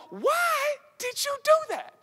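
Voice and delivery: high, put-on voice of a questioner; mock confronting person. Preacher mimics someone confronting a teen, high mock voice. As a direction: squeak it out like a nagging adult. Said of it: "they go".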